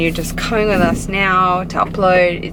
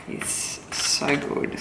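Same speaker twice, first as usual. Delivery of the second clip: whisper; 4 semitones lower, 10 dB quieter, slower than usual. Real, not acted. Whispered praise of sick food.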